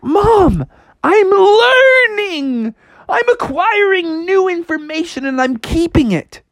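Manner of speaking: high, drawn-out excited cry; hammed-up kid excitement; thrilled Froggy. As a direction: announce the breakthrough to mom, stretching it out.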